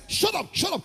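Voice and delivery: high, loud mock shout; angry order. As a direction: yell it twice to silence someone. Kidnappers shout at praying captive.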